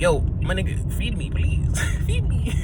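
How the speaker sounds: hungry stomach begging the speaker, laughing. Pretend. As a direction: beg for food, laughing as it climbs. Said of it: "It literally says".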